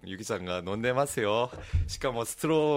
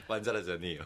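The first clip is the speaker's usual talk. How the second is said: laughing; 6 semitones lower, 7 dB quieter, faster than usual. Real, not acted. Quick laughing denial.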